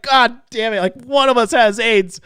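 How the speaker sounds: loud, high-pitched comic panic; hammed-up rising dread; freaking out. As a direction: blurt it out in acted alarm. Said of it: "this guy's freaking me out".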